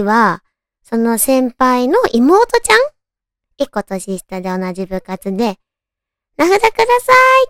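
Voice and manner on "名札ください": high, quick eager ask; eager younger girl. Retold or imitated. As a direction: chirp it out like a shy junior. Younger girl asks for nametag.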